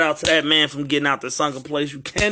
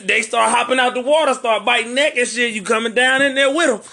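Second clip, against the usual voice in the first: excited storytelling; 7 semitones higher, 4 dB louder than usual. Retold or imitated. Excited retelling of attack.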